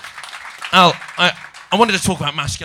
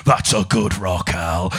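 Bear Grylls impression; 8 semitones lower than usual. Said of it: "he's like".

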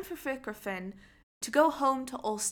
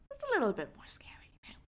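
fast whispered aside; whispering; 6 semitones higher, 6 dB quieter, faster than usual. A narrator's reading. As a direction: whisper it to the kids.

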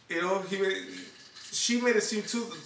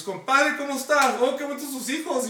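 higher and louder, overly friendly Spanish hello; put-on cheerful innocence; fake cheerful greeting; 7 semitones higher, 5 dB louder than usual. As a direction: greet them like nothing's wrong, too sweet. Husband caught, fakes friendly greeting.

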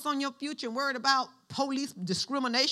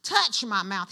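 normal talking voice

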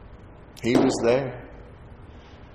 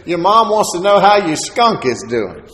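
higher, louder, gleeful teasing; teasing stepdad; 7 semitones higher, 8 dB louder than usual. Retold or imitated. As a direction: rib him like a joking stepdad.

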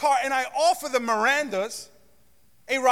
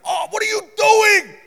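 high yelping shout, imitating John; startled friend; 11 semitones higher, 9 dB louder than usual. John cornered and punched, high startled yelp. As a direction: yelp it like a man under attack. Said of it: "he's just like".

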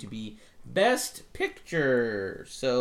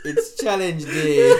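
laughing; 7 semitones higher, 13 dB louder, much slower than usual. Real, not acted. Laughing at friend's switch.

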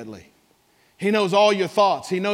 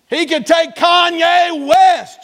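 slow preaching shout; 10 semitones higher, 7 dB louder, slower than usual. Real, not acted. Preacher to his congregation, slow higher shout. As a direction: shout it out slowly, much higher and louder.

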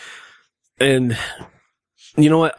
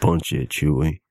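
Harrison Ford line; 7 semitones lower, 3 dB quieter than usual. Acted. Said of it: "Harrison Ford on A Good Day".

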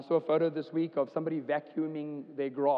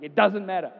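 sudden loud, higher brush-off; mock exasperated answer; 4 semitones higher, 9 dB louder than usual. Dad answering his kids' question, loud mock exasperation. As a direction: snap back at the kids' question. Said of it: "And I'm like".

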